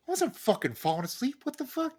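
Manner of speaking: indignant. Retold or imitated. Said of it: "I'm like".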